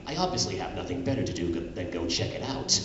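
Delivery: ordinary talk, nothing marked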